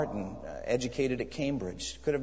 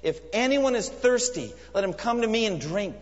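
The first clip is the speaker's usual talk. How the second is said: much higher and louder, calling out to a crowd; 10 semitones higher, 5 dB louder than usual. Retold or imitated. Jesus calling out to the crowd at the feast, loudly. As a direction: cry out the invitation. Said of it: "called out in a loud voice".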